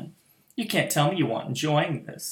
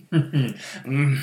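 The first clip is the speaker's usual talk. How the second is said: usual speaking voice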